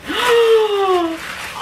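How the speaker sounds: long, high gasp of delight. Real, not acted. Gasp on opening a gift.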